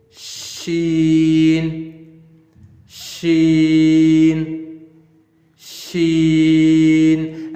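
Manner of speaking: sheen with a long drawn-out sh. Shown as a demonstration. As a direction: stretch the sh of sheen. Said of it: "the way we spell S H and it makes".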